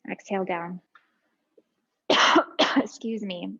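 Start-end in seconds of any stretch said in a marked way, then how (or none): 2.8-3.6 spluttering cough on tea; choking on a drink. Real, not acted. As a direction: cough as tea goes down wrong. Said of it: "I'm choking on my tea".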